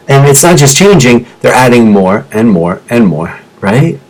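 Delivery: usual speaking voice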